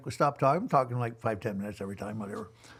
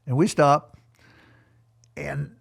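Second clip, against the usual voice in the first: usual speaking voice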